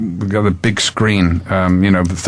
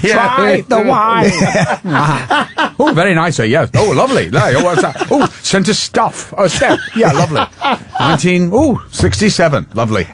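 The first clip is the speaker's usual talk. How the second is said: hammed-up writer; 8 semitones higher than usual. Actor recreates costar's over-the-top wine scene, high laughing ham. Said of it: "when I'm eating and goes".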